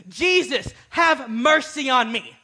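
high, loud desperate cry, slow at the end; screaming for mercy. Retold or imitated. Beggar screaming to Jesus past the crowd, loud and desperate. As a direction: scream his name to be heard. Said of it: "But he kept screaming".

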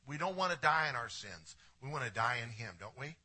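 ordinary talk

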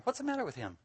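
high, soft mock bewilderment; puzzled angels. Angels puzzling over a man, hushed and high. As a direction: ask it high and hushed, as onlookers.

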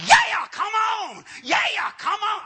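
high, loud hammed-up revival shout; wildly excited preacher. Short pastor summoning people for prayer, over-excited shout. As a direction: imitate a fired-up pastor hollering. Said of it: "this guy was so excited".

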